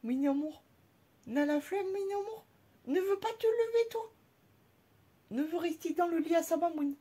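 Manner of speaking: high sing-song pet talk. Real, not acted. Owner cooing baby talk to her lazy pet.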